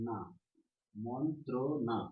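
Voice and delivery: না alone, then slow মন্ত্র না; syllable by syllable. Shown as a demonstration. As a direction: separate each beat of মন্ত্র না. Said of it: "তারপরে".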